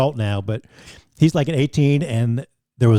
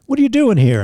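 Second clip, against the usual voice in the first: higher-pitched mock confrontation; mock TV host's challenge; 11 semitones higher, 4 dB louder than usual. Host confronting a repeat guest on camera, mildly mocking. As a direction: question pitched up high in mild imitation.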